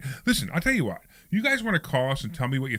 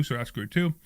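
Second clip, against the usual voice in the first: ordinary delivery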